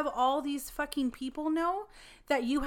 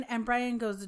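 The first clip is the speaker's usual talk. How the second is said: ordinary delivery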